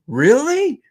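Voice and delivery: high, loud mock-astonished question; incredulous kid. Imitating the kid after hearing "beat her", incredulous squeal. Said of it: "and the kid goes".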